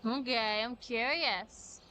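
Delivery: normal talking voice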